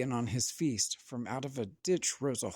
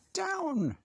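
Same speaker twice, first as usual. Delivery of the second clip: witch crying out. A narrator's reading. Witch yelling to her cat to land. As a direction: shout it like a startled witch. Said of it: "cried the witch".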